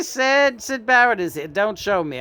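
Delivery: normal talking voice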